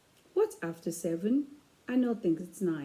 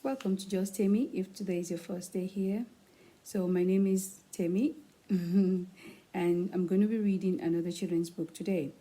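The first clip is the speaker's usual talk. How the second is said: usual speaking voice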